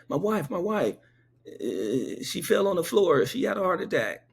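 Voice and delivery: high, urgent retold plea; mock-frantic husband voice; acted panic. Old man voiced in panic.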